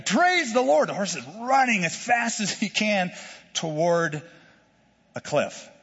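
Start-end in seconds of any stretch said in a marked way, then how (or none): 0.0-0.9 a hammed-up excited praise; rising excitement. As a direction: praise the Lord, much higher and louder. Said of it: "Third time".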